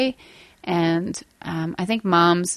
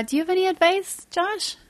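high, fast teasing ask; high sing-song question; 9 semitones higher, 5 dB quieter than usual. Real, not acted. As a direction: lilt it up, quick and high.

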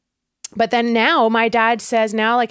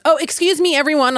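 lightly voiced priest, pitched up; priest's announcement; 6 semitones higher, 3 dB louder than usual. Priest calls back the departing congregation, imitated announcement. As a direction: imitate someone addressing a whole room. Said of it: "the priest goes".

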